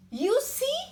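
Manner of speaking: high, loud, rising "you see"; triumphant mouse. Acted. Mouse crowing to the Gruffalo that it was right, triumphant.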